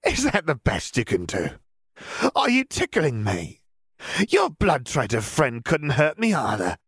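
high, gleeful mockery; shrill, derisive villainess; taunting. A narrator's reading. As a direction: jeer at a feeble attempt. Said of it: "Bellatrix only laughed harder".

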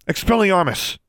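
high, urgent spell cry; casting a spell. A narrator's reading. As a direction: call out the incantation sharply to disarm.

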